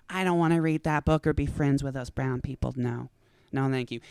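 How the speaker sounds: dismissive believer. Acted. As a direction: turn it down primly. Believer refusing the Quran.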